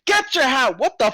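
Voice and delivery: high, loud startled yelp; the vendor catches sight of the bear; hat seller startled mid-call. Acted. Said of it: "he goes".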